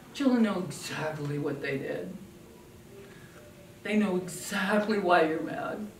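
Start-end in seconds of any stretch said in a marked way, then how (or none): none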